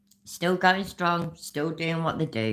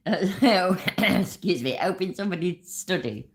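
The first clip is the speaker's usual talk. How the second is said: ordinary talk, nothing marked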